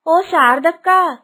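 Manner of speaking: slow, drawn-out loud call; long loud call. Acted. Kusuma calling her neighbour again, slow drawn-out shout. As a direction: stretch the call out to reach next door.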